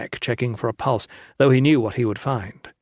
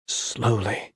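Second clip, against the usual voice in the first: to himself; 3 semitones lower, 5 dB quieter than usual. A narrator's reading. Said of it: "he told himself".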